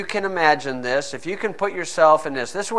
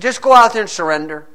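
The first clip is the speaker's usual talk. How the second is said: urgent plea; 7 semitones higher, 8 dB louder, faster than usual. Retold or imitated. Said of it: "He said".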